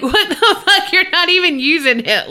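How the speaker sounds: high, loud, half-laughing disbelief; exasperated outburst. Retold or imitated. Telling of dad's unused container, exasperated and laughing. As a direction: blurt it out in amused exasperation. Retelling exasperated reaction to dad.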